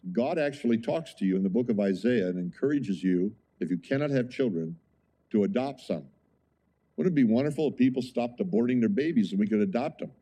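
ordinary talk, nothing marked

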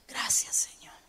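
breathy whispered thanks after a sigh; whispered prayer. Retold or imitated. Pastor whispering thanks to God in the shower, hushed.

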